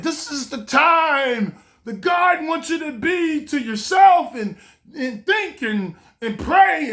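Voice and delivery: a hint of a fervent prayer, high and slow; mock preacher. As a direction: preach it at him, slow and lifted. Friend praying over him.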